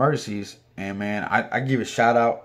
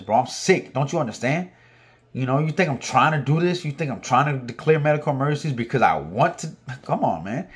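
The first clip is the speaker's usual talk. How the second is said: raised pitch, indignant pleading; exasperated protest; 4 semitones higher than usual. Retold or imitated. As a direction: argue back, desperate to be understood. Retold argument with the captain.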